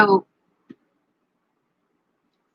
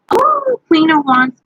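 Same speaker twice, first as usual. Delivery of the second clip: surprised; 5 semitones higher, 6 dB louder, slower than usual. Real, not acted. Surprised at drawn card.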